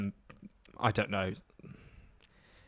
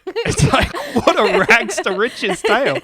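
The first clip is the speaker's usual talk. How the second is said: much higher, loud, laughing and slow; laughing exclamation; 11 semitones higher, 7 dB louder, slower than usual. Real, not acted. Host laughs at her own Mandela comparison, high and slow. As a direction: squeal it out mid-laugh.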